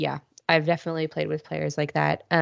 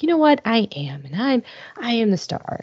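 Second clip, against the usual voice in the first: mock self-important; 7 semitones higher than usual. Voicing cocky player answering the devils.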